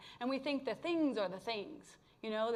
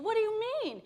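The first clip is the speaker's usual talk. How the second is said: much higher, louder indignant protest; high, louder exasperated protest; exasperated outburst; 7 semitones higher, 5 dB louder than usual. Retold or imitated. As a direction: push back hard at the voice. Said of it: "I got more and more pissed".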